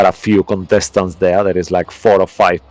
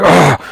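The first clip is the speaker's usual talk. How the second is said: higher acted grunt of effort; strained grunt; 8 semitones higher, 4 dB louder than usual. GM acts out the Cyclops fighting to hold on, strained grunt.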